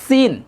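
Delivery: সিন with a dental s, tongue against the teeth; sharp sin. Shown as a demonstration. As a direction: demonstrate সিন with an s, not sh. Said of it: "জিহ্বাটা দাঁতের মধ্যে একদম লাগবে".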